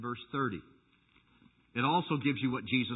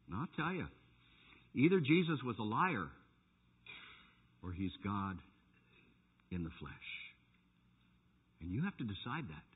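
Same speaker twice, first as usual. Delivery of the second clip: much softer, low and grave; intense hush; 6 semitones lower, 6 dB quieter than usual. Acted. Preacher quietly confronting the congregation. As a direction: drop to a near hush to drive it home. Sudden soft intense close.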